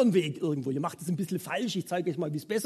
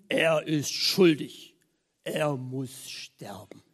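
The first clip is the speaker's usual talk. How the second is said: crowd verdict; 5 semitones lower, much slower than usual. Retold or imitated. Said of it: "Und sie riefen".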